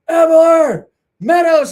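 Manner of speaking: loud, high yell of two surnames; a friend bellowing names from outside the door; calling out. Retold or imitated. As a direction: holler down the hall to find your buddies.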